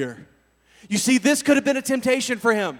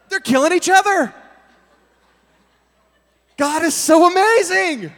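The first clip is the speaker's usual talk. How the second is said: ecstatic; 7 semitones higher, 7 dB louder than usual. Real, not acted. Preacher exulting.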